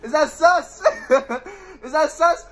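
giggling through the question; amused laughter; laughing. Real, not acted. Laughing at flirty monster.

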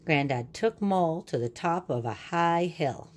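ordinary delivery

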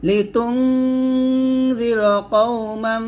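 slow melodic tajweed; Quran recitation. Shown as a demonstration. Teacher reciting the verse to students. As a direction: chant it slowly for them.

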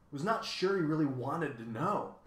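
plain reading voice

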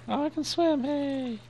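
thrilled to find it can swim; excited squeal. Real, not acted. Gamer discovering swimming, excited high squeal.

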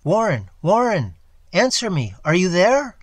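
loud, high calls into the telephone; frantic shouting. A narrator's reading. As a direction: call desperately for a silent friend. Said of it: "shouted, and screamed".

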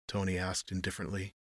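ordinary delivery, nothing marked

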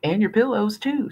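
put-on high screechy little boy's jab; shrill kid taunt; screeching kid. A narrator's reading. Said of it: "screeched the other".

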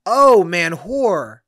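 loud, drawn-out mock realisation; dawning realisation. Comic finally decoding the Scottish woman's words, acted realisation. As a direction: let the penny drop slowly and loudly. Sudden realisation of the misheard word.

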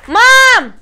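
loud call across the house. Real, not acted. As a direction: shout for mum from afar. Calling her mother from another room. (громко зовёт маму)

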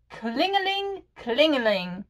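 klingeling said with a clear K and ringing -ling endings; German bell sound. Shown as a demonstration. Showing viewers the German bell sound. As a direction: chime klingeling like a little bell. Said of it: "So it goes klingeling".

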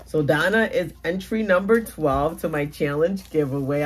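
ordinary delivery

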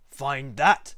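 taunting bird, loud on THAT; scornful challenge; sneering. A narrator's reading. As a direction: sneer it as a dare. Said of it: "sneered the bird".

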